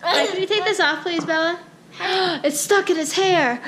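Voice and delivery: usual speaking voice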